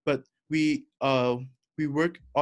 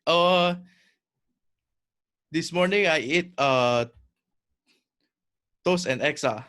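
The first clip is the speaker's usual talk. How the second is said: Singlish; 4 dB louder than usual. Pretend. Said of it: "let's exchange a few sentences in Singlish".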